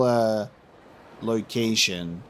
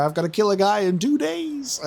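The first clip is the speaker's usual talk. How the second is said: mock exasperation; 7 semitones higher than usual. Exasperated gamer acting out hurry.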